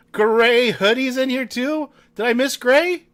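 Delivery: loud, high, incredulous query; surprised question. Real, not acted. Streamer finding a viewer missing from list, surprised.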